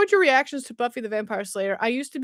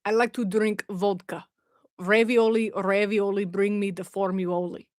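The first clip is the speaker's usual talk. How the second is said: badly faked Russian accent; 3 semitones lower than usual. Shown as a demonstration. Streamer still attempting Russian for viewers, comic fake accent. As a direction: ham up a wobbly Russian accent. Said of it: "I've gotta do my Russian accent".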